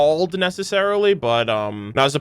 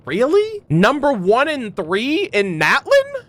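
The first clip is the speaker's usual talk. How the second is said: shocked disbelief; 7 semitones higher, slower than usual. Real, not acted. Shocked by the wheel's prediction.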